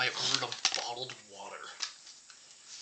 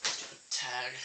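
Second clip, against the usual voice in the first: ordinary delivery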